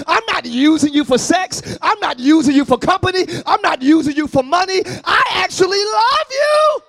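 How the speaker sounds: rising from firm to loud, with "love" on top; building declaration. Acted. Preacher telling the congregation he loves them, building loud.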